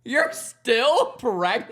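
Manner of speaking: high, loud, drawn-out incredulous question; mock disbelief. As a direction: stretch it out in loud mock shock. Mocking a shocked reaction, laughter follows.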